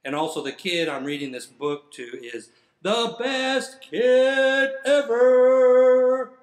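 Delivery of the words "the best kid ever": drawn-out, high, loud exclamation; big praise. Pretend. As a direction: stretch it out and belt it. Forced praise of the kid.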